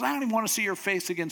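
fast, high acted royal outburst; angry dismissal. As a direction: rattle it out in fed-up anger. Furious king dismisses Haman.

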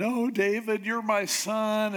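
imitating a tearful wail, lightly hammed-up; mock tearful. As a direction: plead like an overwrought, crying father. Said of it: "And he's crying".

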